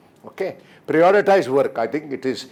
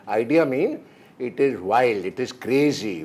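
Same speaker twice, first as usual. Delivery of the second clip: ordinary talk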